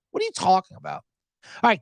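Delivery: normal talking voice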